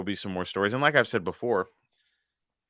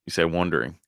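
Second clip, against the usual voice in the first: ordinary talk, nothing marked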